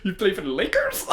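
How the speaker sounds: high mimicked footballer's English, laughing; incredulous rising question, hammed-up; Mbappe. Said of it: "When he's speaking English".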